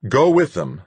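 high, loud order; commanding. A narrator's reading. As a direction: order them off sharply. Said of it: "Duchamp commanded".

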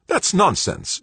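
high, sharp retort; snapping. A narrator's reading. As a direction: snap it back at her. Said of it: "Joanna snapped".

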